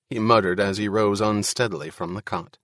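usual speaking voice

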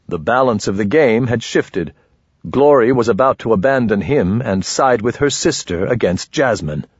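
ordinary delivery

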